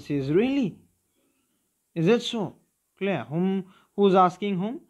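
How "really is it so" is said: higher eager disbelief; child begging grandfather to tell more; excited. Acted. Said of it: "in excitement".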